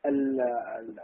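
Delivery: ordinary talk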